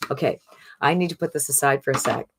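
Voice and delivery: usual speaking voice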